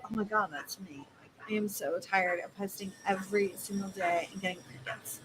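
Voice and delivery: hushed relieved client; inner recognition. Retold or imitated. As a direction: whisper it like they just felt seen. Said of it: "the emotion behind it in their head".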